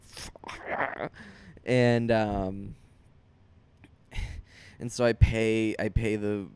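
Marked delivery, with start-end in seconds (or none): 0.0-1.1 breathy under-the-breath swear; whispered curse. Retold or imitated. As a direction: mutter it to yourself, defeated. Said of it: "And I was like".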